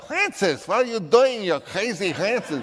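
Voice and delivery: high, loud Viennese boss voice, laughing; mock Viennese accent of an excited boss. Director imitates the studio boss in a Viennese accent, high and loud. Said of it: "he talked like".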